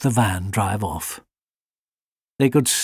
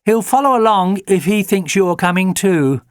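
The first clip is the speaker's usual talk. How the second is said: Jenny's suggestion; 8 semitones higher, 4 dB louder than usual. A narrator's reading. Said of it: "suggested Jenny".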